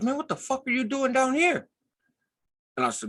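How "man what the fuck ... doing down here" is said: high-pitched imitated disbelief; mock a cop's incredulous challenge, voice way up; incredulous officer. Officer to a parolee far from home, incredulous.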